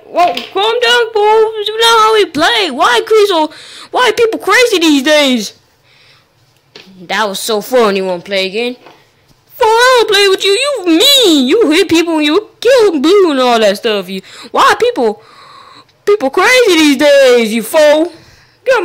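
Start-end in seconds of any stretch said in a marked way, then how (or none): 0.0-5.6 high, loud indignant complaint; indignant whine. Real, not acted. Kid complains after rough play. 9.5-18.8 crying whine. Real, not acted. Crying out after being hurt.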